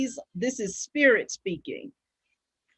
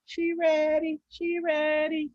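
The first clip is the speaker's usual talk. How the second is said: playful put-on voice; 5 semitones higher, 4 dB quieter, slower than usual. Playful put-on voice, call and response.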